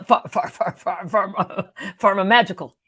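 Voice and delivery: laughing while tripping over the word; tongue-tied giggle; laughing stumble. Real, not acted. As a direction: stumble through pharmaceutical, giggling. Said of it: "It's hard for me to even say it".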